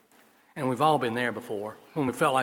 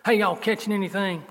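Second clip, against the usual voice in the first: higher, louder Southern call across the water; 7 semitones higher, 4 dB louder than usual. Acted. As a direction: call out "Hey, y'all" like a Southern fisherman. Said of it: "I put the southern terminology in his mouth".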